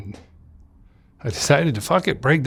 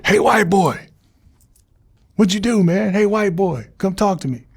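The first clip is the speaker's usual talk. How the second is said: higher, louder voice calling down the cell block; a fellow inmate hollering through the wall; 9 semitones higher, 3 dB louder than usual. Retold or imitated. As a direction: call out to the new guy in the next cell. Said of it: "And then I hear this guy".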